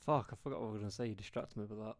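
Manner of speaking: ordinary delivery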